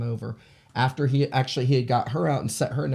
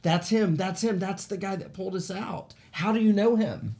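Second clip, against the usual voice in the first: higher-pitched imitation of upset recognition; retold tearful shock; 7 semitones higher than usual. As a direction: mimic her distraught insistence, without real sobbing. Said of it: "she starts crying and bawling and shaking".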